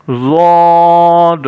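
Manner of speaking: ضاد drawn out very slowly, mouth rounded, full and heavy; heavy rounded letter. Shown as a demonstration. Teacher demonstrating a heavy letter to the class, rounded and drawn out. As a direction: round the lips and stretch ضاد long and thick. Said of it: "تو جب ضاد ہم ادا کریں گے".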